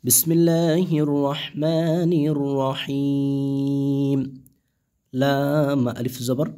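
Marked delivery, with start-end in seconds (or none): 0.1-4.2 slow, drawn-out chanted recitation; Quran recitation. Shown as a demonstration. Teacher reciting for students, slow melodic Quran chant. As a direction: recite it melodically, stretching each vowel.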